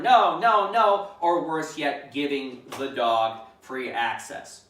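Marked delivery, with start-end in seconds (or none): 0.0-1.1 louder, higher repeated "no"; mock hollering. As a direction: imitate the wrong frantic yelling. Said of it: "As opposed to screaming, hollering".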